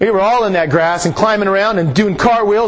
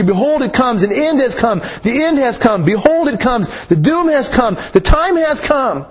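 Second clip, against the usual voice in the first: mounting scripture reading; 4 semitones higher than usual. Acted. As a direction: proclaim each doom line, building higher toward the last. Rereads doom verses.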